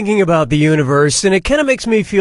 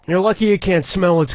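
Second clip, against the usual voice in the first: ordinary talk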